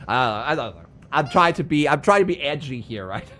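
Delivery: ordinary talk, nothing marked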